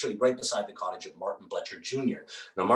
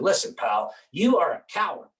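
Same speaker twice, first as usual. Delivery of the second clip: louder, higher jeering accusation; taunting accusation; 8 semitones higher, 5 dB louder than usual. Acted. Martin Bletcher Junior taunting Tom at the party, loud jeering. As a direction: jab the insult at him.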